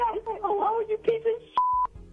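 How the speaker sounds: normal talking voice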